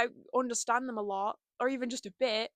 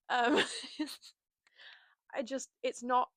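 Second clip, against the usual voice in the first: tearful; 3 semitones higher than usual. Real, not acted. Emotional moment, cry heard.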